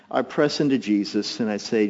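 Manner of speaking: ordinary talk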